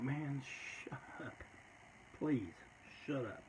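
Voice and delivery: tense whispered pleading; hushed plea. Retold or imitated. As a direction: beg him under your breath to shut up. Begging friend to stop taunting.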